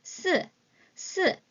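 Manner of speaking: 스 said high and crisp as Mandarin sì; model pronunciation. Shown as a demonstration. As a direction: snap out 스 for repetition. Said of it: "teacher models number four".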